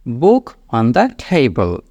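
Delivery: very slow, each word clear; slow model sentence. Shown as a demonstration. Teacher showing learners what to say at the sink, very slow. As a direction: lay it out slowly to be repeated. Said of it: "টেবিলের উচ্চারণটা table".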